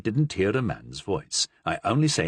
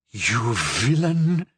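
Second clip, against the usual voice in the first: through his teeth; much slower than usual. Acted. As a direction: force it out between teeth. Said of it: "said through his teeth".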